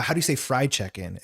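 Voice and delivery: ordinary talk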